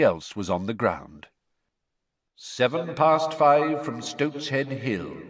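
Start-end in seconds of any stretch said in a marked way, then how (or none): 2.4-5.2 slow, higher, sing-out announcement; arrivals announcer. A narrator's reading. Voice announcing the arrival from Stoat's Head Hill, slowly intoned. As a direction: call it out like a station announcer.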